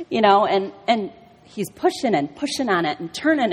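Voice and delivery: normal talking voice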